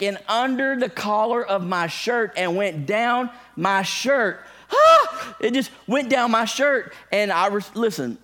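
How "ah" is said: high shrieking "ah!" much louder and higher; a sudden yelp of panic; panicked scream. Retold or imitated. Pastor reliving the spider going down his shirt, shrill shriek.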